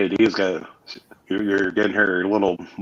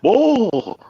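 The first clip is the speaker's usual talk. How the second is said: King of the Hill voice; 4 dB louder than usual. Retold or imitated. Imitating King of the Hill character.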